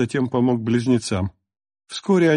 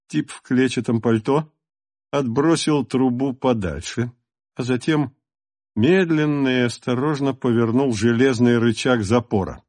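ordinary delivery

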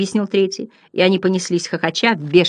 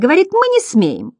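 high mocking report; clown butterfly; 11 semitones higher than usual. A narrator's reading. First clown to the company, high comic voice.

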